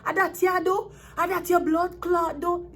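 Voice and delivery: high, loud Jamaican patois outburst; imitating a furious Jamaican boyfriend in patois; Jamaican man cussing. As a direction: cuss her out like an outraged Jamaican man. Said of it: "A Jamaican man would be like".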